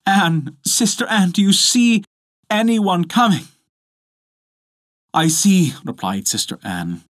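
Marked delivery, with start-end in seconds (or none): 0.1-3.5 high, urgent call; crying out. A narrator's reading. Desperate wife calling to her sister, crying out. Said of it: "and then she cried". 5.1-5.7 high, louder reply; Sister Anne calling. A narrator's reading. Sister reporting from the tower, calling down.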